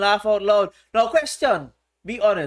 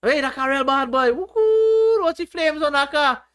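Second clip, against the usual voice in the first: excited schoolkid; 6 semitones higher than usual. Retold or imitated. Teacher recalls schoolkids shouting at a flashy car, high and excited. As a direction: cheer a cool car like a schoolboy. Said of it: "I remember in primary school".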